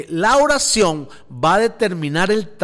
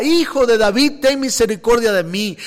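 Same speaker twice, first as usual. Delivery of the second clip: crying out; 5 semitones higher than usual. Retold or imitated. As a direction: call out to Jesus over the crowd. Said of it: "más gritaba y decía".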